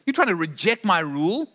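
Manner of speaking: God can't believe anyone would defy him; incredulous. Pretend. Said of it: "It's almost like he can't believe it".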